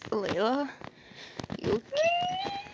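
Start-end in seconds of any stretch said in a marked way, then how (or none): 1.6-2.6 very high and slow, squeaky; silly high voice. Pretend. Checking on a laughing friend, slow squeaky falsetto.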